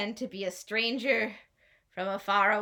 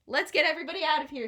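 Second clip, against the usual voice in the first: urgent rally; 5 semitones higher, 9 dB louder, faster than usual. A narrator's reading. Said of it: "is like".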